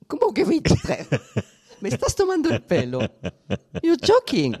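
real shock breaking into laughter; incredulous laughing disbelief. Real, not acted. Incredulous at the penis size. (incredulidad con risa, mucho más aguda y fuerte)

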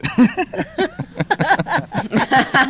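high-pitched burst of laughter; amused laughing fit; real laughter. Real, not acted. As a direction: laugh out loud, long and high. Amused reaction to the apology remark.

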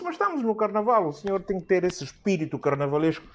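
high, insistent carnival plea; pleading high voice. Retold or imitated. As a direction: push the plea up high and coaxing. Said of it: "Eu disse". (súplica aguda e insistente)

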